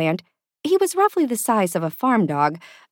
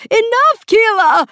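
very high, loud panicked shout; frantic, high-pitched yell; exasperated shout; 9 dB louder than usual. A narrator's reading. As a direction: cut them off in desperation. Panicked assistant cuts her off.

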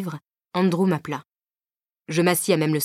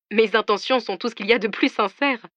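mock offended, laughing; 5 semitones higher than usual. A narrator's reading. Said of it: "s'offusqua-t-il en riant".

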